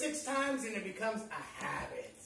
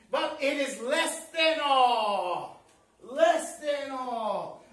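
slow, shouted refrain with stretched words; preacher's climax; 4 dB louder, much slower than usual. Real, not acted. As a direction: proclaim it big, drawing out "all".

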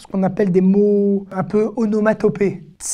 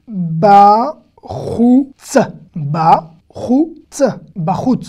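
slow, separated syllables; 4 dB louder, much slower than usual. Shown as a demonstration. Teacher decoding a Hebrew word for learners, syllable by syllable. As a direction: isolate each sound, with pauses between.

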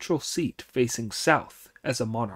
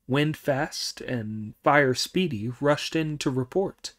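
ordinary delivery

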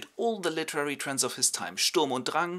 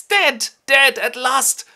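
much higher, louder gloating cry; gleeful villain; 13 semitones higher, 13 dB louder, much slower than usual. Acted. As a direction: crow with delight at his death. Franz gloats over father.

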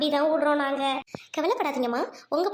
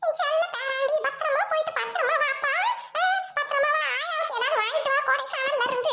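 high-pitched granny fondly fussing; doting grandmother; 10 semitones higher than usual. Acted. Grandmother seeing off her darling grandson, high and doting.

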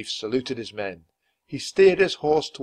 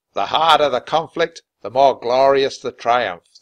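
loud, slow and stately oration; commanding speech; 3 semitones higher, 10 dB louder than usual. A narrator's reading. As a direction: proclaim it to the troops. Commander reads the speech aloud.

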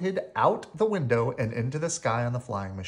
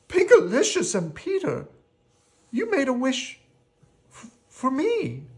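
high, halting character voice; high storybook voice, hesitant at the end; moved little character; 12 semitones higher, 4 dB louder than usual. Acted. Moved Rocky thanks the children.

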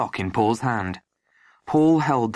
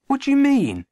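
high, louder startled question; baffled protest; 5 dB louder than usual. A narrator's reading. Boy staring at his contradicting friend, baffled protest.

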